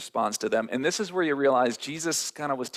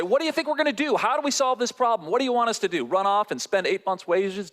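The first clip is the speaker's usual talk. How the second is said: much higher, pleading questions; exasperated disciples; 10 semitones higher than usual. Acted. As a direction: throw up your hands at Jesus.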